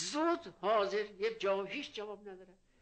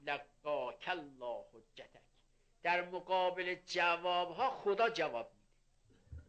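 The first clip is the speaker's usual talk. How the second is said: ordinary talk, nothing marked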